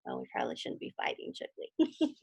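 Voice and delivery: whisper. Real, not acted. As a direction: lean in and whisper a gentle warning. Reader's fast aside to child.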